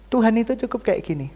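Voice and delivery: usual speaking voice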